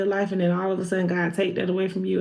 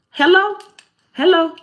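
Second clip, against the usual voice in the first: mock calling out; 8 semitones higher, 5 dB louder than usual.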